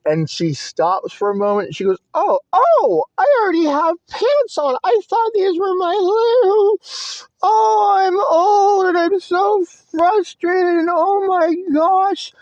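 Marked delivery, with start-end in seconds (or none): 2.1-12.3 high-pitched, crying grandmother voice; upset grandmother. Retold or imitated. Grandmother realises her mistake with the pants, high tearful wail. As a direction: wail it in frustrated, tearful distress.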